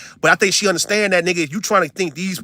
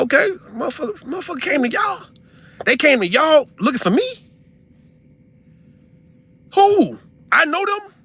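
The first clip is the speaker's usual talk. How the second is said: incredulous; 9 semitones higher than usual. Retold or imitated. Retold outraged questioning.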